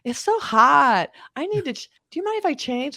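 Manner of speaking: high-pitched put-on coquettish voice; coy ruse. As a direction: act the flirty damsel to distract the guards. Retold flirtatious ruse on guards.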